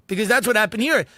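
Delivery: normal talking voice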